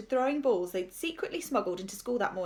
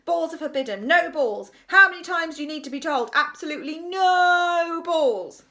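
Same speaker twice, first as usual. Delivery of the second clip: loud, raised-pitch scolding; angry ranting; 7 semitones higher, 9 dB louder than usual. A narrator's reading. Ranting teacher character in story.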